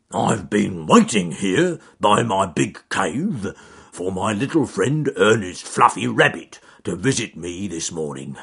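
a big bear grumbling to himself; gruff growly bear. Acted. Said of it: "in a great, gruff, growly voice".

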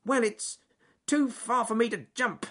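high squeaky grumble; Jim Red Squirrel grumbling. Acted. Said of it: "muttered Jim Red Squirrel".

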